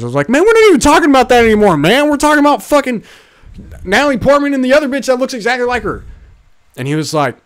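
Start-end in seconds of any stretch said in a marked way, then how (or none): 0.3-6.0 fast, high exasperated rant. Retold or imitated. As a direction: fire it off, fed up and speeding. Retold exasperated argument with stranger.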